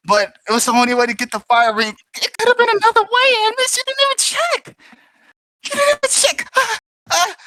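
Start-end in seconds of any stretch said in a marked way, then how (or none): 2.2-3.8 crying. Acted. Grief over dead ally. 3.8-4.8 upset. Acted. Repeated accusation, voice rising. 5.7-6.5 crying. Acted. Repeated, breaks into crying. 6.6-7.3 crying. Acted. Grief over dead ally.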